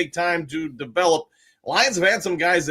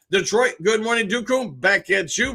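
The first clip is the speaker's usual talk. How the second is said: normal talking voice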